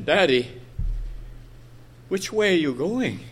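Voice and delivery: soft, lightly voiced child's question; innocent little girl. Little girl asking her father his way, softly innocent. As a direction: gently imitate a child asking, pausing after Daddy. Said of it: "she innocently asked him".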